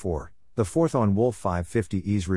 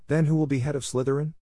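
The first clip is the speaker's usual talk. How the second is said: high, protesting cry; mild protesting screech; 6 semitones higher, faster than usual. A narrator's reading. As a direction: protest shrilly. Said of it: "screech of protest".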